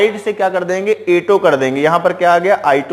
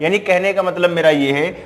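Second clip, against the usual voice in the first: normal talking voice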